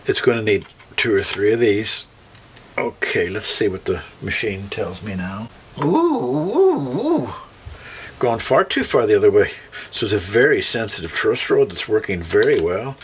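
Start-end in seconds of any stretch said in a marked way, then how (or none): 5.8-7.3 surprised ooh. Real, not acted. Truss rod overcorrected, surprise.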